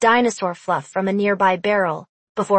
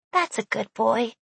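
soft, cooing praise to a pet; 5 dB quieter than usual. Acted. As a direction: coo softly to the little creature. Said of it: "Sophie cooed".

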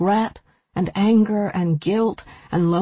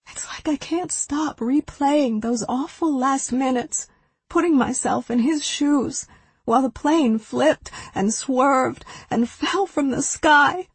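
climbing higher, near breaking; rising anguish; 7 semitones higher than usual. A narrator's reading. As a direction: let the pain build to a crack. Grief rising, higher to sky.

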